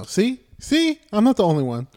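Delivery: high, loud gloating; triumphant. Real, not acted. To his friend who asked to explain, high, loud and triumphant. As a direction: crow it, vindicated. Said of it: "vindicated to friend".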